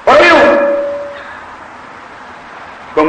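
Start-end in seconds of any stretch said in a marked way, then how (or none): 0.1-0.6 high, sudden shout; acted-out order to get out; angry order. Mother orders the youth out.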